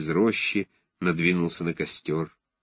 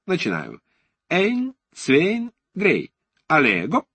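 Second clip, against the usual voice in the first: put-on higher, showy conjurer with a German accent; booming circus ringmaster; 4 semitones higher than usual. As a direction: announce the trick like a ringmaster. German-accented conjurer performing a trick.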